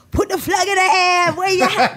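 loud, high-pitched call to the crowd; shouted dancehall stage call; dancehall crowd hype. Retold or imitated. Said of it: "dance hall shows and stuff".

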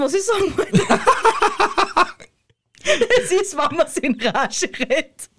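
embarrassed giggly laugh; laughing at herself. Real, not acted. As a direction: laugh through it, caught out. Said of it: "ich hab vergessen".

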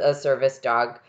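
normal talking voice

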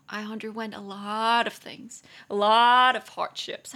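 to an old friend, a mock-tragic joking reply. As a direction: ham up the suffering as a joke. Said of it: "你就直接跟他开玩笑嘛".

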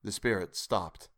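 usual speaking voice

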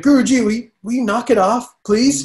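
mock exasperation at a nagging uncle; exasperated plea. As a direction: beg the pushy uncle to back off. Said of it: "annoying uncle".

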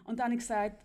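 ordinary talk

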